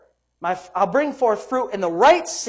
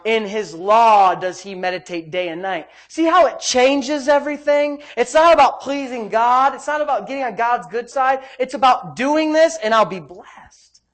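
loud, raised preacher's delivery; forceful preaching; 4 semitones higher, 6 dB louder than usual. Real, not acted. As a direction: drive it home to the congregation.

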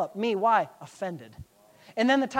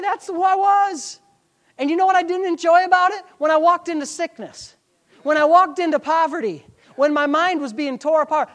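high, forceful sermon delivery; impassioned preaching; 8 semitones higher, 7 dB louder than usual. Acted. Preacher confessing his offended past to church, impassioned preaching. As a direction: testify loudly to the congregation.

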